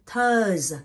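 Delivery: "Thurs" loud and drawn out, buzzing on the final S; final S sound. Shown as a demonstration. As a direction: hold the end of "Thurs". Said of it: "So you got S in".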